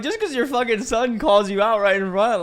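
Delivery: loud, high retold rant; high-pitched indignant yelling; indignant outburst. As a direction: blurt it out in mock outrage. Retold outburst against the mom.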